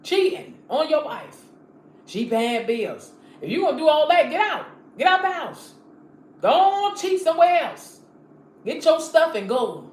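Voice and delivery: loud, high scolding of a cheating husband; angry scolding. Acted. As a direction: tell him off loudly and get him out.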